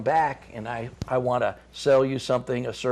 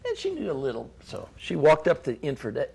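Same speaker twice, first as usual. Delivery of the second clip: usual speaking voice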